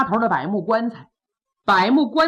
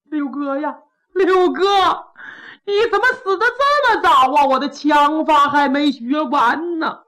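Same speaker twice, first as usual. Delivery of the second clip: higher, louder, crying out for his brother; loud wailing; 5 semitones higher, 4 dB louder than usual. Acted. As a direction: bawl the grief out loud like a rough soldier. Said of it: "也大放悲声".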